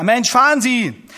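loud, slow, exasperated yell; impatient shout. Retold or imitated. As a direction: bark at the driver to move. Commissar yelling at the driver.

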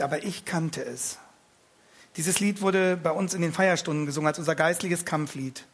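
ordinary delivery, nothing marked

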